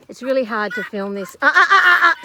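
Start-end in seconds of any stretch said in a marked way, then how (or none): 1.4-2.2 sharp warning. Real, not acted. Warning the dog off.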